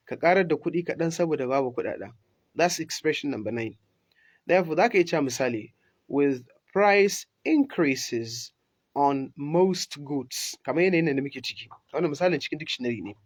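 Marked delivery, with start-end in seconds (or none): 6.1-10.6 slow and deliberate, word by word; careful dictation pace. Shown as a demonstration. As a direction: read it out slowly for learners. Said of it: "ku tsaya ku ji shi sosai".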